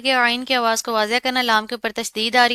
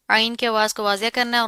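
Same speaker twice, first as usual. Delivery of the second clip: ordinary delivery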